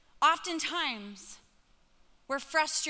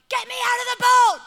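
mock panicked yell; 10 semitones higher, 12 dB louder than usual. Mock cry from the boat.

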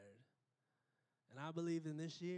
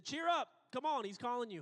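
calling out; 7 semitones higher, 8 dB louder than usual. A narrator's reading. Crowd calls the blind man.

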